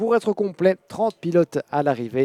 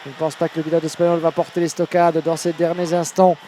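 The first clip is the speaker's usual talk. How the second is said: urgent play-by-play, pressing forward; motorsport commentator; 3 semitones higher, 4 dB louder than usual. Acted. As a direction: call the last lap with urgency. Live French race commentary.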